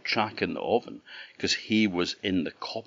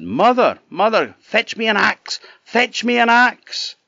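much higher, loud urgent yell; shouting for mother; 8 dB louder than usual. A narrator's reading. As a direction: yell down for the axe, high and loud. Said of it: "and he shouted".

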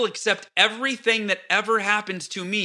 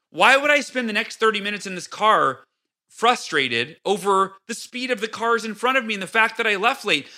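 ordinary talk

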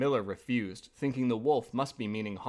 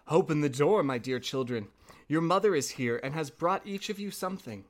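ordinary delivery

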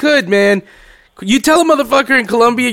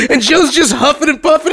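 usual speaking voice

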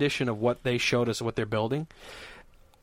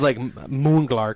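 usual speaking voice

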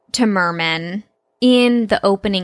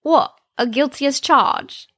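higher-pitched mock British accent; bad British accent; 4 semitones higher than usual. Shown as a demonstration. Another attempt at Bob's line, even worse British accent. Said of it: "Maybe I'll do, like".

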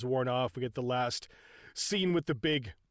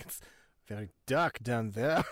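low, put-on French detective; lightly voiced Clouseau, deadpan; 6 semitones lower than usual. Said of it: "Clouseau comes back up and he's like".

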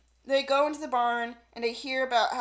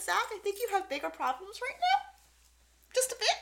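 much higher, dry teasing voice; mock high teasing; 10 semitones higher than usual. Host telling Zach his priorities are off, high teasing. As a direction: tease him in a high sing-song.

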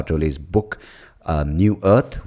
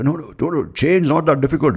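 dismissive know-it-all; 9 semitones higher than usual. Retold or imitated. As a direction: wave away the problem like a smug advisor. Mocking people who dismiss change.

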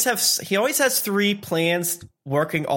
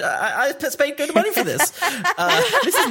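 indignant; 9 semitones higher than usual. Acted. Indignant rant, rising pitch.

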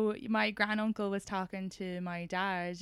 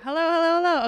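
loud, raised-pitch soundcheck call; shouted mic check; 7 semitones higher, 11 dB louder, faster than usual. Shown as a demonstration. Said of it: "Oh, yeah, me too".

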